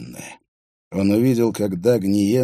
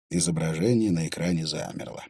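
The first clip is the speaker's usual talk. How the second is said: usual speaking voice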